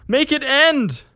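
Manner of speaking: very high, loud agonized scream; drawn-out peak of screamed begging; screamed plea. A narrator's reading. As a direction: scream it out in desperation. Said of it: "He screamed now".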